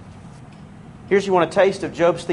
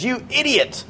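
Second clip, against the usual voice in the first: higher-pitched curt rebuke; short with them; 7 semitones higher, 3 dB quieter than usual. Retold or imitated. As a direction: imitate a blunt, tactless doctor's put-down. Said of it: "is real short with them and says".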